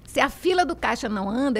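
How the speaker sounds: ordinary talk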